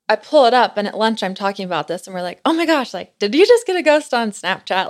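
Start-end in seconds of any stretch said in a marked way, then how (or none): 2.4-4.9 higher, louder mock amazement; joking disbelief. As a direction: kid around with playful, exaggerated surprise. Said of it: "kinda joking about it".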